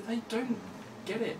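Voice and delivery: high, exasperated sigh of a line. Retold or imitated. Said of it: "you just go".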